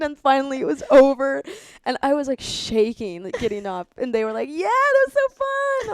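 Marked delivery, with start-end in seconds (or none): 4.5-5.9 high, drawn-out squeal of delight; hammed-up excitement; mock gleeful cheer. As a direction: imitate thrilled friends, slowly and way up high. Acts friends' glee after the ride.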